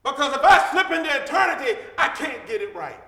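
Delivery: usual speaking voice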